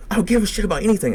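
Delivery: high, fast, loud imitation of her; heated, rapid mock yell; retold outburst. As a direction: mimic her lashing out in a rush, pitch way up.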